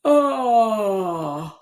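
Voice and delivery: a long open-jawed yearning "oh"; yearning sigh. Shown as a demonstration. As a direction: let out a longing "oh" with the jaw dropped. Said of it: "drop your jaw as if you're yearning".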